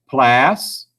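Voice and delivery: 'Plas' alone, vowel held; sounding it out. Shown as a demonstration. Teacher listens for the vowel, sounding out 'Plas'. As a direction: stretch 'Plas' as a separate syllable. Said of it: "So let me say that again".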